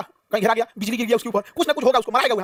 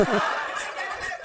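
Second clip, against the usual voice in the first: low, quiet chuckle; real laugh; 3 semitones lower, 10 dB quieter than usual. Real, not acted. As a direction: chuckle softly after your own joke. Teacher's joke about ghosts still existing.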